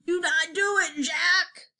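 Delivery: high, wailing screech; frightened giant crying out; screeched plea. A narrator's reading. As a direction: screech it in desperate fear. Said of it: "he screeched".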